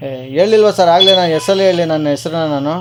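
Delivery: ordinary delivery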